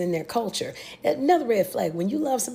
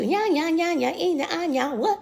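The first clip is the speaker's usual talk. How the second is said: higher put-on shrugging voice, yeah yeah yeah; a careless villager, mocked higher; 8 semitones higher than usual. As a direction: brush it off like you couldn't care less. Mocking the villagers again.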